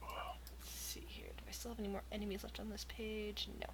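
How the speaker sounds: whispered aside to himself; whispering. Real, not acted. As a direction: murmur under your breath. GM muttering to himself.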